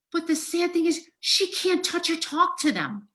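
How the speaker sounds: real upset, voice pitched very high; overcome, pleading on behalf of the dying; high, distraught outpouring; emotional. Real, not acted. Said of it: "I'm gonna get emotional here".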